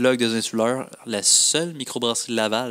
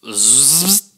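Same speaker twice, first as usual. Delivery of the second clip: buzzing power-tool zzz; impact wrench noise. Shown as a demonstration. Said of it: "Demande-moi de faire n'importe quel bruit".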